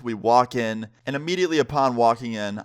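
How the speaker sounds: usual speaking voice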